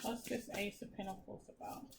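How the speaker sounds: soft voice asking the cards; quiet question. Real, not acted. Reader quietly asks the tarot deck, softly. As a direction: ask the deck softly under your breath.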